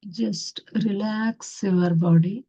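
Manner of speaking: very slow, soothing meditation guidance; guided meditation. Acted. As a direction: ease them into stillness, word by word. Guides meditation with long pauses.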